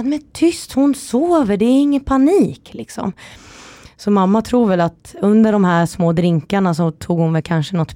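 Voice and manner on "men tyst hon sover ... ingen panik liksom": high, insistent imitated shushing; exasperated hushing, retold. Mother calming the panicking grandmother at the door, exasperated.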